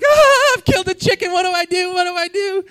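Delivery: high, loud panicked cry; high-pitched mock panic, voice cracking up; panicked boy. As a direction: freak out like a kid who just killed the chicken. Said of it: "He's like".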